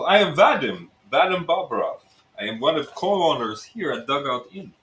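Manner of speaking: Russian innkeeper introducing himself; Russian accent. Acted. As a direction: play a Russian bar owner with a thick accent. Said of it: "it's Russian".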